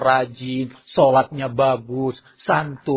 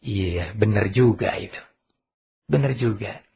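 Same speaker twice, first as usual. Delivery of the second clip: calm father; 6 semitones lower, 3 dB quieter than usual. Acted. Father calmly agrees in room.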